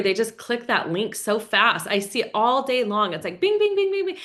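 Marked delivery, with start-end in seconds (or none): 3.4-4.2 high, quick pinging bing bing; notification pings. Retold or imitated. Describing her phone blowing up, she mimics pings high. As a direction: mimic a phone going off nonstop.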